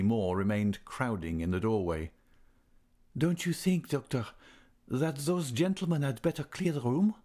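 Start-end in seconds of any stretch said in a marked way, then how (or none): 3.2-7.1 put-on higher-pitched young man, coolly asking; cool request. A narrator's reading. Young man asking the doctor to clear the room, coolly. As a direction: put it coolly in a raised character voice. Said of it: "asked Raoul coolly".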